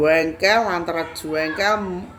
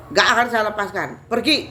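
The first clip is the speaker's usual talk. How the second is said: raised, loud refusal and command; perintah mengusir; 4 semitones higher, 5 dB louder than usual. Retold or imitated. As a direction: refuse firmly, then order it out loudly. Refusing and ordering the spirit out. (mengusir dengan tegas dan keras)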